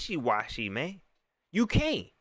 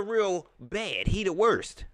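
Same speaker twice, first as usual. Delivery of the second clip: normal talking voice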